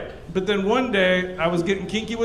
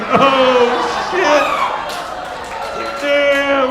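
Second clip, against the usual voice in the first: louder, startled "Oh, shit"; stunned; 4 semitones higher, 4 dB louder, much slower than usual. Retold or imitated. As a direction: react like you just got burned. Surprised at her retort.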